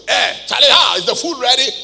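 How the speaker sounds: loud, blunt call for food; a loud, rough demand of the wife; rude husband demand. Retold or imitated. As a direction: shout it like an uncouth husband. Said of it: "don't, don't talk like that".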